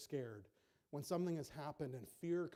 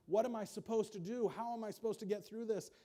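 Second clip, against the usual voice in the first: high, loud, frantic questions; mock panic; 6 semitones higher, 6 dB louder than usual. Preacher acting out a panicked believer, higher and louder. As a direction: flap like someone in a panic.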